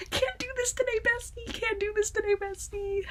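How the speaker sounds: squeaky high voice, pretend whine; high-pitched mock whimper to the viewers; high put-on meme voice. Meme voice, overwhelmed by the show.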